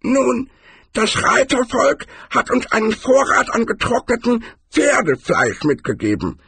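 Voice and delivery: very high-pitched barbarian character voice; high character voice, far from the narrator's; Cohen. A narrator's reading. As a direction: squeak it out high, explaining troll habits. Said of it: "stellte der Barbar fest".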